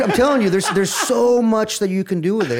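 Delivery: bubbling over with glee; laughing excitement. Real, not acted. Telling a fellow wrestler, laughing and excited.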